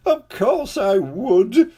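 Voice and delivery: high, very slow, crying king voice. A narrator's reading. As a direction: stretch each word through tears. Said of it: "cried the king".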